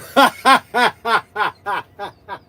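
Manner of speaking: long staccato laughing fit; real cackling laugh. Real, not acted. Streamer warned about nudity on YouTube, cracking up. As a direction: laugh hard until the breath runs out.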